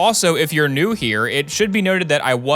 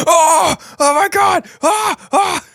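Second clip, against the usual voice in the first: very high, loud mock screams; screaming in horror; 6 dB louder, much slower than usual. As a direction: shriek in panic at a collapse. Acts out character collapsing, others screaming.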